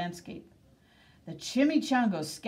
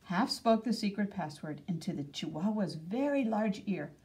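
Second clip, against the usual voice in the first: plain reading voice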